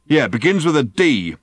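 high, loud heated reply; goading. A narrator's reading. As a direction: hurl the clue at him loudly. Driver goads Arthur.

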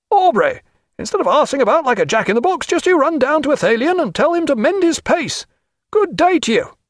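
high, blustering shouted tirade; angry senior captain. A narrator's reading. Wet senior captain scolding officers, high angry bluster.

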